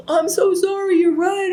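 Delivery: high, teary mock apology; imitating bawling. Apologizing to her fiance after he listened, mock tearful. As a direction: squeeze the apology out high, as if crying. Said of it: "I just started bawling".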